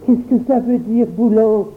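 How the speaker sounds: put-on high, anxious child's question; alarmé. A narrator's reading. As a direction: ask in growing alarm. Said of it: "s'écria le pauvre Jacques alarmé". (question inquiète et aiguë d'un enfant)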